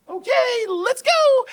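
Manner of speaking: very high, loud mock squeal; gleeful send-off. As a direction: shriek it like an over-eager driver. Acts out Shelley setting off.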